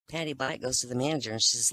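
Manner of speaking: normal talking voice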